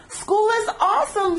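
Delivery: high excited shout; a big happy shark shout. A narrator's reading. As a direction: shout it out with glee. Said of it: "shouted Clark the shark".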